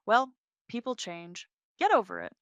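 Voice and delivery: high, loud brush-off; arrogant, dismissive. A narrator's reading. Mistress dismissing the wife, arrogant and scornful. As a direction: cut her down with breezy scorn. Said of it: "a perfect display of arrogance and dismissiveness".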